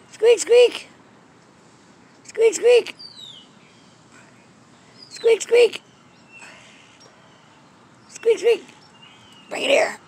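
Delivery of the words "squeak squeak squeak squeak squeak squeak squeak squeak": very high squeak, squeak, a bit louder; squeaky toy imitation. Owner squeaking like the toy to tempt her cat, high mimicry.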